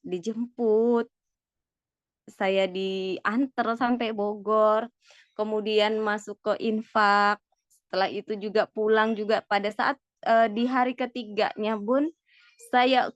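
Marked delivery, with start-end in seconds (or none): none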